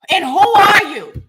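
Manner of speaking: loud, slow shouted challenge; angry shout. Real, not acted. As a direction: demand it, fed up. Angry at rude commenter.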